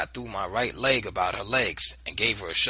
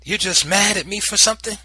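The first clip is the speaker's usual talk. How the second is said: raised, sharp and resentful; indignant accusation; 10 semitones higher, 10 dB louder than usual. Acted. Boy accusing his angry aunt, raised and resentful.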